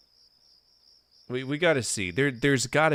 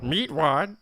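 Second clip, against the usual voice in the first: Meatwad voice; 5 dB louder than usual. Retold or imitated. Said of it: "God bless if I could do that".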